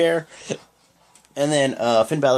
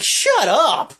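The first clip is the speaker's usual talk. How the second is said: higher-pitched retold shout; mock yell; 11 semitones higher, 3 dB louder than usual. Roman telling Strowman to shut up, mock yell. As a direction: snap it like an angry wrestler cutting him off.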